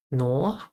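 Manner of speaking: "nor" with an or-vowel, rising as a question; trying "nor". Shown as a demonstration. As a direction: test out "nor?" with an open vowel. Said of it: "maybe then it could be nor".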